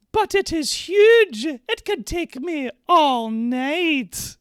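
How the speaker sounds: very high, loud, slow falsetto maid; prim maid voice. Acted. Streamer reading the maid's lines, high falsetto. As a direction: squeak it out like a coy, flustered maid. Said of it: "I'm playing both the characters".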